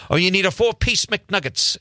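ordinary delivery

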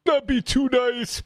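high, put-on podium voice; Donald Trump. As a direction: mimic him addressing a crowd. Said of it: "saying police should".

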